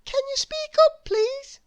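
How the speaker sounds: high, polite mock-puzzled baker; feigned deafness. A narrator's reading. Baker feigning deafness to Mister Noisy, high and polite. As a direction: pretend you can't hear him. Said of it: "putting her hand to her ear".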